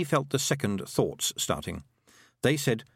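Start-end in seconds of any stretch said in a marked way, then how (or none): none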